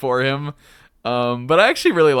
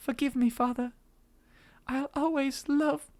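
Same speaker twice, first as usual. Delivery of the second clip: put-on higher, soft fading girl voice; dying girl; 10 semitones higher, 7 dB quieter than usual. Dying Anna speaks softly to her father. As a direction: breathe out last words as a dying girl.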